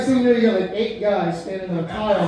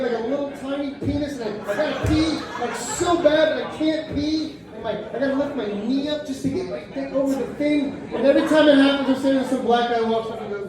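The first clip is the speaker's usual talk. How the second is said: normal talking voice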